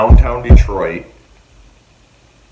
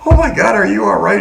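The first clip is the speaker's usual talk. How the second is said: mock alarmed aunt; 10 semitones higher, 4 dB louder than usual.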